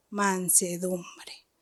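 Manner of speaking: whispered, slow. Acted. To the congregation, closing on meekness: slow whisper. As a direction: breathe the word "mansedumbre" as a soft whisper.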